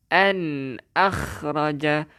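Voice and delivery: An akhraja drawn out slowly with a clear nun; teacher's slow Tajweed demo. Shown as a demonstration. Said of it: "jelaskan untuk izhar halqi".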